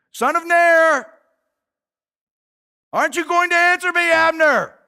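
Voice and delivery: loud high call across the distance. Acted. David shouting to Abner from the hill, loud call. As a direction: keep calling him out loud.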